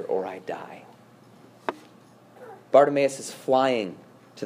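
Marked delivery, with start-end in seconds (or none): 0.0-0.8 low, near-whispered last words; hushed. Acted. Preacher closing a hymn verse, hushed. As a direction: let the line sink to a hush. Solemn close of recited hymn.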